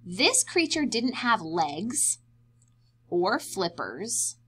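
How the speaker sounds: plain reading voice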